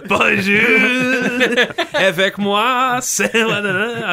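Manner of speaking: hammed-up French bonjour; French accent. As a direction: lay on a cheesy French accent. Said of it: "The French hello".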